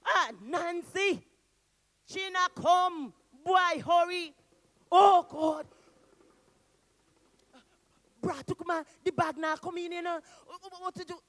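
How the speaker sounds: loud, high, panicked calling; bawling out. Acted. Brat Kuma warns Anansi that Mister Chin comes, panicked bawl. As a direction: bawl a frantic warning from the trees. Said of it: "He bawled out".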